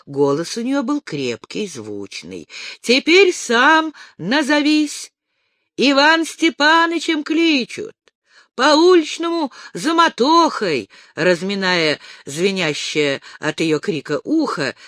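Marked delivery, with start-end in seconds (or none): 2.8-10.9 loud and higher, slowed; shouting back. A narrator's reading. The ataman, deafened, yells his name back at Dasha, loud. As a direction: bellow your own name like a deaf man.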